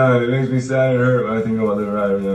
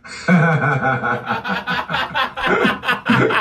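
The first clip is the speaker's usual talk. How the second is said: long high-pitched cackle; mocking laughter; 10 semitones higher, 3 dB quieter than usual. Real, not acted. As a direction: laugh hard at the boaster. Scoffing at viewer's boasts.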